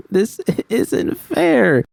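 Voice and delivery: very high, slow, sob-broken; sobbing. A narrator's reading. Judy sobbing to V about her life, very high and slow. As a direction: force the words out between sobs. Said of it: "I managed between sobs".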